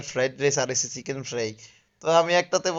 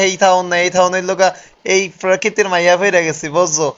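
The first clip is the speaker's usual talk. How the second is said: excited shouting; 3 semitones higher, 8 dB louder than usual. Real, not acted. Telling his brother how much he loves this place, loud and excited. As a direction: shout your joy over the noise.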